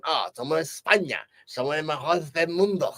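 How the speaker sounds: lightly put-on Spanish accent; amateur Spanish accent, declaiming; attempted Spanish accent. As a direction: play a proud queen with a rough Spanish accent. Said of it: "I can't do a Spanish accent, sorry".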